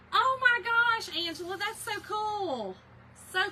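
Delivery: real delight, high and loud; excited gush. Real, not acted. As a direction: gush over a viewer's picture. Reacting to a viewer's photo live.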